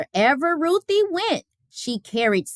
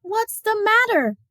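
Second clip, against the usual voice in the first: higher, gentle mother asking; concerned Mama; 11 semitones higher than usual. Acted. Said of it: "asked Mama".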